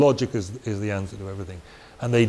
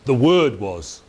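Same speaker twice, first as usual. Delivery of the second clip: "The Word was." with "Word" pressed; stress on Word; slower than usual. Shown as a demonstration. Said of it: "He emphasizes".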